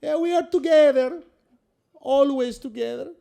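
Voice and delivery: sentimental mock Italian accent, gushing; overacted Italian mama saying goodbye. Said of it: "speaking in mostly that Italian accent".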